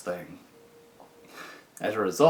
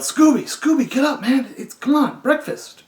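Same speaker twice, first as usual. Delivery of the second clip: very high, loud urgent wake-up yell; 6 dB louder than usual. Retold or imitated. Prankster yelling at the door to wake his cellmate. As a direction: fake alarm to trick him. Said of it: "kicked the door and yelled".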